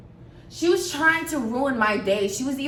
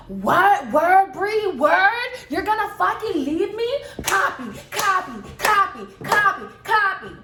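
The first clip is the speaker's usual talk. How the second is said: mock belligerent yell; 7 semitones higher than usual. Friend's belligerent yelling retold.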